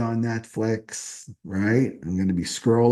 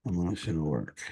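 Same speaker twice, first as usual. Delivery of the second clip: lower, softer repeat to herself; soft echo; 5 semitones lower, 9 dB quieter than usual. Shown as a demonstration. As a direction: let the phrase sink in quietly. Quiet echoed repeat of phrase.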